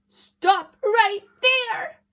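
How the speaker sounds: high, loud, very slow command; furious granny yell. Acted. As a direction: shout him down. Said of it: "yelled Granny".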